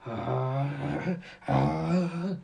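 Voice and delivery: drawn-out "ah", rising on the second; strained cry of powering up, building; power-up yell. Acted. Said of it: "As Vegeta begins to say".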